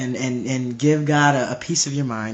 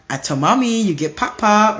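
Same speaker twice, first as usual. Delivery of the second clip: put-on higher little-kid tattling threat; little sister threatening; 6 semitones higher, 4 dB louder than usual. Little sister threatening to tell mommy, childish tattling. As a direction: raise the pitch, baby-talk warning. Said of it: "they wanna threaten you with these weird threats".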